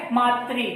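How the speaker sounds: मातृ said as maatri, the ri clearly sounded; correct pronunciation. Shown as a demonstration. Teacher repeating the correct pronunciation of मातृ for students. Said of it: "सही जो उच्चारण है इसका क्या है".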